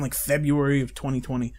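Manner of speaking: ordinary talk, nothing marked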